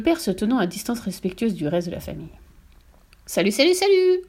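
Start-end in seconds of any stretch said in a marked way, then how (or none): 3.2-4.2 high parrot voice; high-pitched bird greeting; parrot call. A narrator's reading. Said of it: "lança de nouveau la voix".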